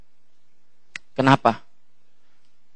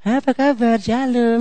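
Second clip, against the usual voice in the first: higher, put-on sweet greeting; fake cheerful greeting; 10 semitones higher, slower than usual. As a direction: greet brightly while hiding a bad mood. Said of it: "Padahal gini".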